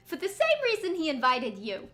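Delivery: loud put-on character voice; Zack. Game character answering a question, put-on voice. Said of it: "I should do my Zack voice".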